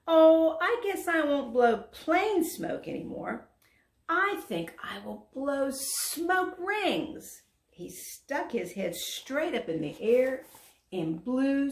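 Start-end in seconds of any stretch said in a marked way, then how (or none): 0.1-7.4 high and loud, for all to hear; a dragon talking loud to make the animals stay; loudly. A narrator's reading. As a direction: announce it so the others listen. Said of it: "he said loudly".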